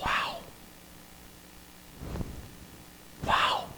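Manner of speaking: soft, breath-filled amazement; hushed awe. Real, not acted. Preacher facing the eternal God, in hushed awe. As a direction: let it out quietly, struck with awe. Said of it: "I'm awed".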